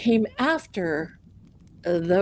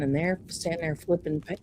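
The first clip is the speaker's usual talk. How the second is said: ordinary delivery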